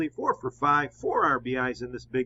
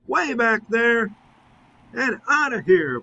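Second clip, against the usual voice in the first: rising, louder call as the ball flies out; excited home run call; 6 semitones higher, 5 dB louder than usual. Acted. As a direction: sell the homer to the crowd, drawn out at first.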